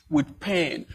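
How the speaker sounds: usual speaking voice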